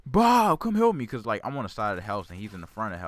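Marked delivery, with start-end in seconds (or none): 0.1-1.1 put-on high, loud desperate child's scream; screaming for help. Small kid screaming for his dad from the side of the house, high loud scream. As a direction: shriek for help like a stung kid. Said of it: "I'm like".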